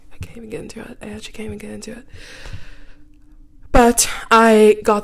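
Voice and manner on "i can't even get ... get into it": very soft, dropping into a whisper; hushed giving up. Real, not acted. Too worked up to explain further, hushed. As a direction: let the voice fall away to a whisper.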